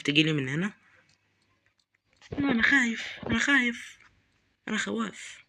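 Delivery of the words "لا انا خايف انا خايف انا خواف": frightened, much higher voice; real fear pitched up high; scared. Real, not acted.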